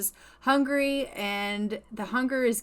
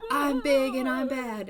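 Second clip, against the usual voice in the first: higher, put-on big bad voice; trying to intimidate; 4 semitones higher, 3 dB louder than usual. Woman scaring the wolf away from her, mock intimidating. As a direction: puff yourself up to scare off an animal. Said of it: "she's trying to scare this animal away".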